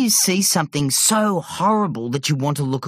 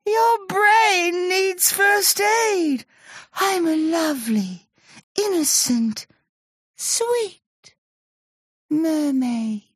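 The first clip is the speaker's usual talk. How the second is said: very high, sugary innocent voice; fake sweet mermaid. A narrator's reading. Monster faking sweet mermaid.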